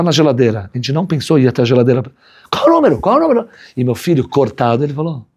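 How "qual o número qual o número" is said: high, frantic repeated question; panicked. Retold or imitated. Parents panicking over emergency number, high and frantic. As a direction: ask in panic, voice jumping up.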